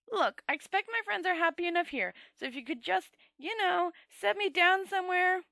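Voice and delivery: high, anxious pleading voice; a nervous wizard begging the Dragon Lord to put him down. A narrator's reading. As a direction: plead your way out, pitched high. Rincewind pleads again.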